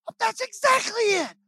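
high, loud burst of delighted agreement; overjoyed yes, that's it; excited exclamation. Retold or imitated.

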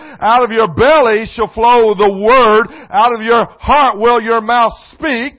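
loud, slow pulpit proclamation; booming preacher voice; full-force preaching. Acted. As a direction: thunder the scripture out slowly to the church. Preacher declaims scripture.

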